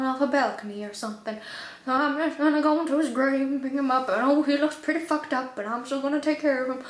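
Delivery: hammed-up distraught grandfather, rushing through the drama; amateur theatrical pleading, lightly voiced; Mallory. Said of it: "now I'm Mallory".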